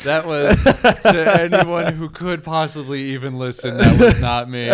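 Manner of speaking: loud denial through laughter; laughing shout. Acted.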